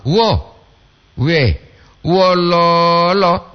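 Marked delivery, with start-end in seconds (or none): 2.0-3.5 "Wololo" with the middle lo held long and level; "lo" panjang. Shown as a demonstration. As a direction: draw out the double O in "Wololo". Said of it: "Dua O di lo itu". ("Wololo" dengan O di "lo" ditahan panjang)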